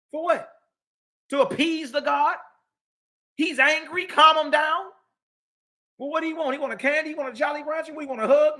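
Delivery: high-pitched sarcastic coddling questions; mock-soothe as if pacifying a tantruming child; mocking appeasement. Mocks appeasing an angry god.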